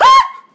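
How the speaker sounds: sudden high, loud yelp; mock horror-movie shriek cutting off the words; startled shriek. Heroine startles in the house.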